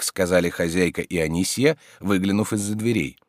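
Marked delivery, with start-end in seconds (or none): none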